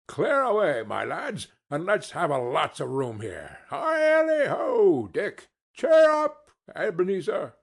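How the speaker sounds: hearty, high, loud calls of a jolly master; jovial Fezziwig. Acted. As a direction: boom it out like a merry old merchant. Fezziwig voiced in story.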